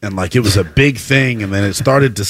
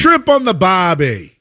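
slow, higher mock Australian accent; 8 semitones higher, slower than usual. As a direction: drag out a cheesy Aussie cliché in a mock Australian accent. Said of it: "That's an Australian accent".

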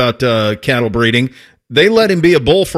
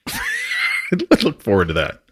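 laughing; 6 dB quieter, slower than usual. Real, not acted. Host laughs at guest's boast.

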